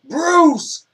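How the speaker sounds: high, slow, loud battle cry; epic shout. Acted. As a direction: roar the command over the music. Epic outro command shout.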